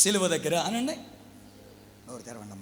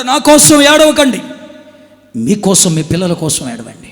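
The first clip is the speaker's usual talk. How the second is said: slow booming cry of the words; Jesus' words thundered from the pulpit; loud proclamation; 15 dB louder than usual. Acted. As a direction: cry it out to the crowd. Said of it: "అందుకే ప్రభు అన్నాడు".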